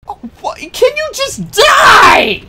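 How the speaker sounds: high exasperated shout; raging gamer. Real, not acted. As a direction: scream at the enemy to die. Yelling at enemy in game.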